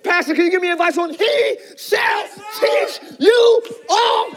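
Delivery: slow, drawn-out shouted preaching; rising sermon climax, shouting and drawing out each word; full-force preaching. Acted. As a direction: preach it big and stretched out.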